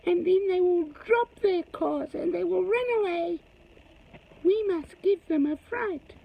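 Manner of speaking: high squeaky little creature, scheming; small creature voice. Acted. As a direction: squeak it out like a tiny plotting creature. Small gazumper characters plotting.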